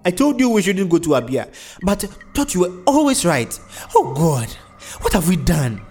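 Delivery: loud, high lament; distraught. A narrator's reading. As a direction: reproach him in anguish. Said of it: "Missus Kalu continued".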